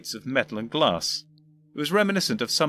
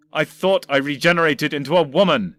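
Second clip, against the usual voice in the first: louder exasperated protest; 4 semitones higher, 7 dB louder than usual. Acted. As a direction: blurt it out. Doctor protests.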